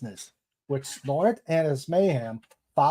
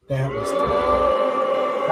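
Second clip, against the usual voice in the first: much higher, slow mock shriek on "That was dumb"; mock outburst; 3 dB louder, much slower than usual. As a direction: yell "That was dumb" high and stretched out. Repeats verdict as outburst.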